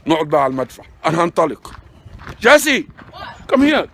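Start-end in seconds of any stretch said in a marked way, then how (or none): 2.4-3.9 loud high call across the room. Real, not acted. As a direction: shout her name to get her over. Calling friend loudly. (نداء عالي على جاسي)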